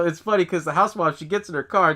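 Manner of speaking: usual speaking voice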